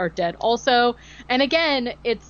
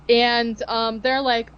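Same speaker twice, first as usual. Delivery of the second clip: ordinary delivery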